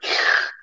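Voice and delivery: wet sucked-in slurp; slurping drool. Retold or imitated. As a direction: imitate sucking drool back up. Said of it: "like, suck the drool up".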